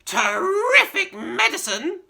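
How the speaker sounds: high, slow cackling screech; delighted old witch shrieking; crying out crone. Acted. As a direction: crow it out gleefully. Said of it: "she cried".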